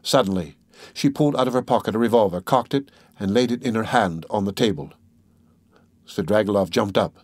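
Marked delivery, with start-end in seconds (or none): none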